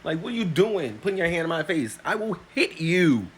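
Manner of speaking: retold confrontation, loud and heated; angry warning. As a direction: warn him off with rising heat. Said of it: "I was like".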